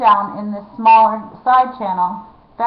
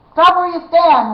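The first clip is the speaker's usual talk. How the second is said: warning shout; 8 semitones higher, 8 dB louder than usual. A narrator's reading. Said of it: "warned Hold Your Nose Billy".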